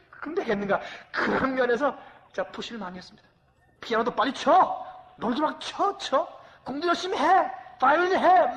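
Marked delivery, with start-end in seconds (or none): 3.8-8.6 높고 크게 몰아붙이는 고함; 딸을 다그치며 소리치듯; pushy father yelling. Retold or imitated. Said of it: "막 이렇게 막 제가 푸시를 많이 했습니다". (연습하라고 딸을 다그치는 아빠, 높고 큰 고함)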